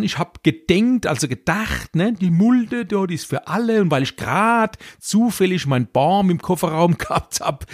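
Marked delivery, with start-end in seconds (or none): 0.0-1.1 high, feigned-innocent excuse; mock out-of-town dumper. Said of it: "Das Übel fängt hier schon an". 2.1-7.7 high, feigned-innocent excuse; mock out-of-town dumper. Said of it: "Weinehmer oder Hemsbecher".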